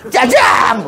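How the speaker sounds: drawn-out mock holler; hammed-up wishing cry; comic shouted wish. Shown as a demonstration. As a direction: call it out like a magic word.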